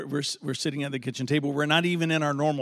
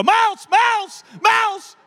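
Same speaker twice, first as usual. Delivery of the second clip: panicked shout; 8 dB louder, much slower than usual. Retold or imitated. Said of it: "Vanessa would go".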